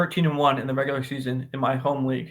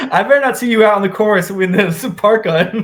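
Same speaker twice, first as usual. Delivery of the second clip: laughing, louder and higher as you tease; 6 semitones higher, 8 dB louder than usual. Real, not acted. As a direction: tease him through real laughter. Teasing friend about cold golf.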